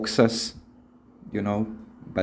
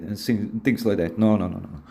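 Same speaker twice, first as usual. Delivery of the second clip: ordinary delivery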